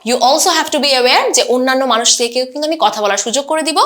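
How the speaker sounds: usual speaking voice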